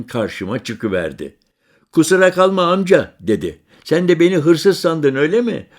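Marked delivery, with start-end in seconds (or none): 1.9-3.1 put-on higher, louder young boy's voice; child voice. Boy apologising to the stranger, high and loud. 3.8-5.7 higher, louder playful tease; teasing. Acted. Narrator teasing the boy, playful and raised.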